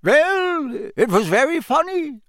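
very high voice, drawn-out opening; shrugging Bulgarian minister. A narrator's reading. Bulgarian Minister to Fudge, unrepentant high voice. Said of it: "said the Bulgarian Minister, shrugging".

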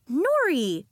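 high, loud reproving name-call; scolding. A narrator's reading. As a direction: cut in with a scolding cry. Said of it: "Dahlia scolded".

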